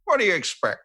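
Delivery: high-pitched quoted retort; Matt Berry. Retold or imitated. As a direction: toss it off as another person's lofty reply. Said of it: "he was like".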